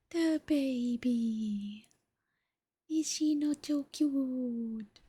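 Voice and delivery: hushed, adoring pet voice. Real, not acted. Baby talk to her cat.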